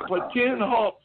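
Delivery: lightly barked drill sergeant command; imitated drill command. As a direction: call the platoon to a stop. Retold sergeant calling a command.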